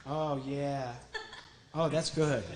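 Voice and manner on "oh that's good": usual speaking voice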